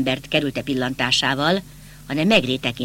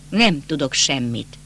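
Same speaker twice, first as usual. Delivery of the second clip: usual speaking voice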